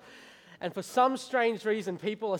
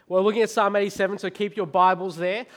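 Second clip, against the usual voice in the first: ordinary talk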